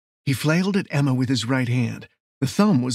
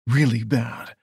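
ordinary delivery, nothing marked

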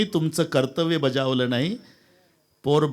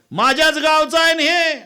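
high, loud village woman's cry; higher, louder old woman's voice; old woman calling out; 11 semitones higher, 6 dB louder than usual. Acted. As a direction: shout it at the judge.

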